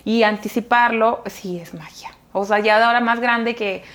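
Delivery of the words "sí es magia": soft confiding whisper. Real, not acted. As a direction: let it drop to a secret whisper. Confiding what worked for her.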